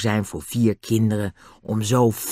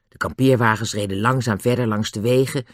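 plain reading voice

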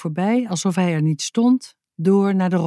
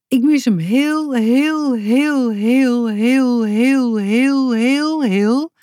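high, sing-song chain of drawn-out words; gushing emphatic repetition; 6 semitones higher, 4 dB louder, much slower than usual. A narrator's reading. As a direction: pile up HEEL in one long breath. Confesses missing him, endless repetition. (overdreven lang herhaald "heel")

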